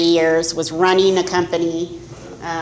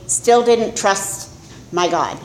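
ordinary talk, nothing marked

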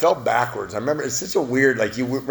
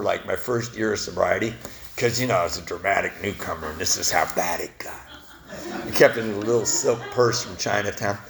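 usual speaking voice

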